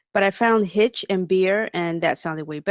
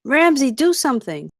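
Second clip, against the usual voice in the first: urgent plea; 6 semitones higher, 5 dB louder than usual. Acted. Lola pleading during the fight.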